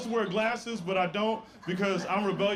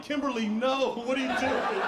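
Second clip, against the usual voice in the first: pleading with the TV; 6 semitones higher than usual. Retold or imitated. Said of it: "I'd be like".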